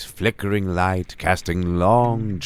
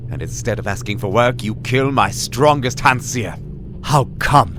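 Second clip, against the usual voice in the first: angry; 6 semitones higher, 4 dB louder than usual. Acted. Glotha interrogates the killer.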